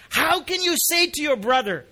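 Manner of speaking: high, loud rebuking question; strong, astonished how. Acted. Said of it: "how strong this how is".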